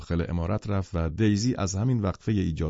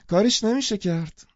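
much higher, ringing and cheerful; bright and clear; 13 semitones higher than usual. Acted. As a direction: announce it brightly to the table. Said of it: "با روی گشاده و صدای رسا گفت". (با روی گشاده و صدای رسا و زیر)

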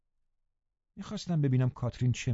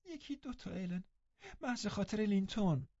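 high, soft pleading; tender pleading girl; begging; 10 semitones higher, 8 dB quieter than usual. Acted. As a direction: beg for just a couple. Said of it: "به من التماس کرد".

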